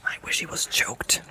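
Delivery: very low, soft, whispered grumble; muttered curse. A narrator's reading. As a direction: mutter it darkly under the breath. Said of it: "he muttered".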